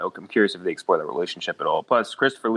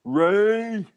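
high mock call of Ma, Ray; Brad Garrett impression; 13 semitones higher than usual. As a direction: call out Ma like a sitcom son. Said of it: "You do it so much better".

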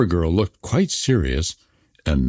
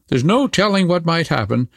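put-on high robber girl voice; 6 semitones higher than usual. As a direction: shrug off danger, then ask about Kay. Robber girl talks to Gerda.

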